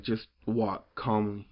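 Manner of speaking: slow, even "walk calmly"; calm slow advice. Retold or imitated. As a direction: lay it out slowly. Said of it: "great line".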